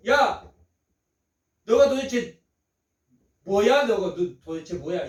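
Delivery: mild mock disdain. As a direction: imitate scoffers demanding who he is. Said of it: "이렇게 비꼬는".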